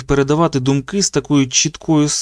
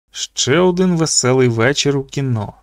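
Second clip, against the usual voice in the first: ordinary delivery, nothing marked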